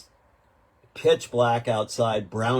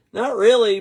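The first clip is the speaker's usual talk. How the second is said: drawled local reply; 12 semitones higher, 10 dB louder than usual. Retold or imitated. Storyteller mimics owner's casual reply. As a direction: play the laconic local owner.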